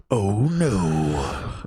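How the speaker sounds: drawn-out hammed-up wail; mock dismay. Host clowning for his co-host, hammed-up mock dismay.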